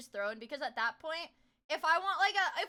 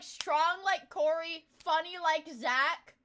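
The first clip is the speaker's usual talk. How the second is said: slow reading; 3 semitones higher, 5 dB louder, much slower than usual. Acted. Reading shirt slogan.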